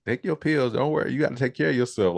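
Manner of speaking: high, breezy, dismissive auntie; mock auntie voice. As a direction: play the careless aunt brushing her off. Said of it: "She was just like".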